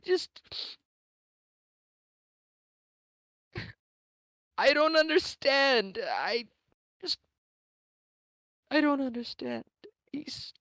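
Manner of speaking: high, tearful sobbing lines; sobbing woman in her hands. Acted. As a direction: cry out the words in despair. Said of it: "just begins to sob".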